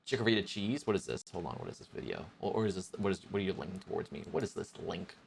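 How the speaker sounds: confused mutter. Real, not acted. Streamer reading a strange chat link, quiet and puzzled.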